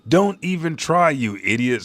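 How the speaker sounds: high, loud villain's jeer; acted scornful shout. Saint Somers riding his thorn beast, jeering. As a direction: start high and loud, then drop.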